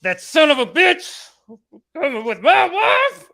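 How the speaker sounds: slow, high, loud shout; husband raging at the man with his wife, shouting slowly; furious husband. Retold or imitated. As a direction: roar it out like a betrayed husband. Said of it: "the husband is just like".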